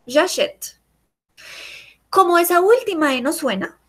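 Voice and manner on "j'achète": "J'achète" cut off at the final t; silent final E. Shown as a demonstration. Teacher repeats the present for the student, final E silent. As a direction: end "J'achète" on t, no vowel after. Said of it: "La última E no suena".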